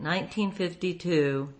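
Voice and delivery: usual speaking voice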